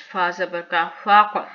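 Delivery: फाक with the final q released in an echo; qalqala bounce. Shown as a demonstration. Teacher spelling for learners, फाक ending in a rebounding q. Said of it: "का ऊपर कलकला".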